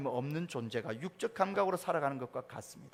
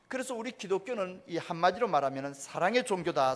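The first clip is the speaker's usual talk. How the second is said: ordinary talk, nothing marked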